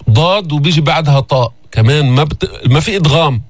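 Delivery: ordinary talk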